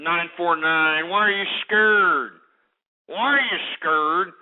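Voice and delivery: slow, drawn-out jeer; goading singled-out challenge, words stretched; mocking taunt. Real, not acted. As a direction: needle him, dragging out "scared". Keeps taunting the caller.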